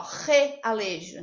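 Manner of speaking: "realejo" with the first R drawn into a rasped guttural sound; exaggerated strong R. Shown as a demonstration. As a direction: split off "re" in "realejo" with a heavy throaty R. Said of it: "Parece que o R tem um som forte".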